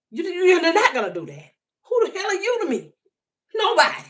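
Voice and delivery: high, loud indignant retort; angry retort. Real, not acted. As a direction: put the critic in their place. Confronting critics on her page.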